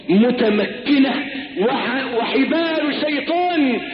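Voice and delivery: urgent preaching, rising high; impassioned warning. Acted. Preacher warns the congregation against desire, impassioned preaching.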